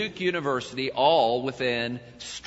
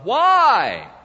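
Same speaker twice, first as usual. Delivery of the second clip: anguished cry; 10 semitones higher, 10 dB louder than usual. Acted. We ask God in pain.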